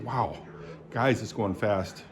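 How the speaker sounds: normal talking voice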